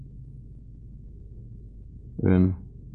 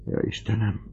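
whispered sigh; 6 semitones higher than usual. Acted. Dying man sighs to God.

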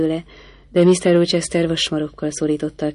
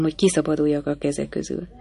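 ordinary delivery

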